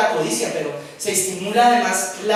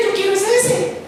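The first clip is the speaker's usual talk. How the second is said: antojo infantil fingido; 10 semitones higher than usual. Acted. El codicioso que quiere otro.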